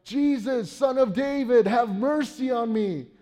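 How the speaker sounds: high, loud plea to a passing healer; a beggar on the street crying out for mercy. Retold or imitated. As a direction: call out over a crowd, begging. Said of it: "they cry out".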